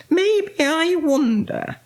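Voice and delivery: high, slow wondering; pondering witch. A narrator's reading. Winnie wonders how to get home, high slow pondering. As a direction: draw it out high, thinking aloud.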